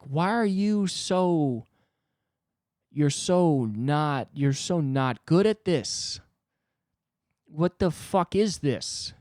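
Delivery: slow, drawn-out disbelief; incredulous. Retold or imitated. Inwardly asking the awkward comic why, slow exasperation. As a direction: stretch each word in exasperation. Inner reaction to awkward small talk.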